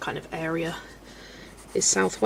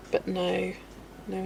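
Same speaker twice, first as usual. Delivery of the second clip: ordinary talk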